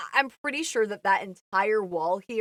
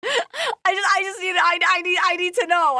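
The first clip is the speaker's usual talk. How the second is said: laughing; 6 semitones higher, 5 dB louder than usual. Real, not acted. Still laughing, pleading.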